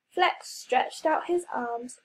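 ordinary delivery

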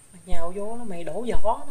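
sudden loud scolding burst; retold scolding. Scolding the man in retold quarrel.

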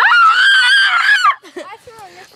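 very high laughing shriek. Real, not acted. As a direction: burst into a squealing laugh. Laughing shout mid scuffle.

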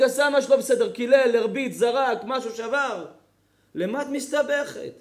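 ordinary delivery

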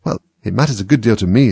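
plain reading voice

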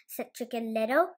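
ordinary delivery

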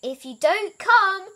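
high, loud, slow call; calling out a warning. A narrator's reading. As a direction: warn someone out of sight. Elmer calls up a warning.

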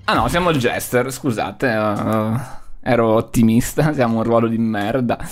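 deflated grumble. Real, not acted. Deflates, realizes he's jester.

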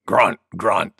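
low, gruff-animal grunted words; wild boar grunts. A narrator's reading. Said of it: "said Wild Boar".

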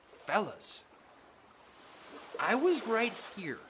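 soft, gentle reminder; gentle rebuke. Acted. Jesus gently speaks to his soaked disciples, tender. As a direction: tenderly point out the obvious.

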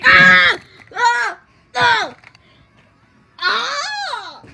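loud, high "ah" yells; repeated high cries of alarm; sudden screams. Pretend. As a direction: burst into mock terrified screaming. Sign-off cut by screams.

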